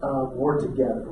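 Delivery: low, soft heartfelt apology; tender sincerity. Retold or imitated. Husband apologising to his wife that night, low tender sincerity.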